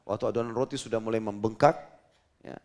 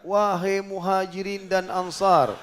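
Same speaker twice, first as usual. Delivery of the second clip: higher, louder and slow call to the crowd; loud slow proclamation; shouting out; 7 semitones higher, 7 dB louder, much slower than usual. Retold or imitated. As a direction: call out over a big crowd, stretched. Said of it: "lalu beliau teriak".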